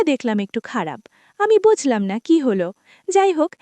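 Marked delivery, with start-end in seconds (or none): none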